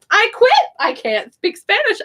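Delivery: high-pitched mock exasperation; mock giving up. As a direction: throw in the towel, playfully overacted. Retold exasperated outburst in class.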